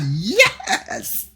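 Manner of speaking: high, loud laughing cheer. Real, not acted. Reader cheering the telling-off.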